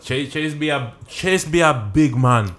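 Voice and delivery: put-on Jamaican accent. Comedian hypes his friend Chase, in a put-on Jamaican accent. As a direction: talk like a Jamaican, drawing it out. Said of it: "I'm in the Jamaican accent".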